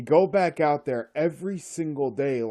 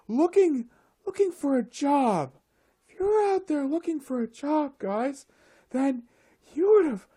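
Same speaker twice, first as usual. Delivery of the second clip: high airy mock-preachy voice with whooshes; mocking windy optimist; 11 semitones higher, 4 dB louder than usual. Ranting about airheads, mocks their breezy advice in a high voice. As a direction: lampoon the breezy job-advice crowd, pitched way up. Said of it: "these really windy individuals".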